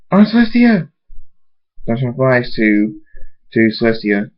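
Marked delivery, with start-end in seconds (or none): 0.1-0.9 higher-pitched alarmed call; alarmed cry. Acted. Thunderstorm finds his aunt in the ruins, alarmed cry. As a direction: call out in alarm to a loved one.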